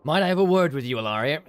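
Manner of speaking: a first try, too American; plain noble read, a bit American; American-leaning noble voice. Shown as a demonstration. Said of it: "He's been more, more American".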